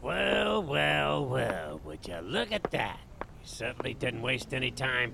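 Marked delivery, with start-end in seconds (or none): 0.0-1.8 knowing well well well. Acted. Detective eyeing posters, drawn out.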